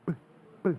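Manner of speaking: B as a quick bare "buh"; phonic sound of B. Shown as a demonstration. Pastor correcting pronunciation, B as a short "buh" sound. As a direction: pop out B as "buh", without the letter name. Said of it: "You pronounce it B".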